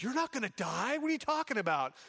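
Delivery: high, disbelieving outburst; indignant protest. Retold or imitated. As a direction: push back as if it's absurd. Peter protests Jesus' death.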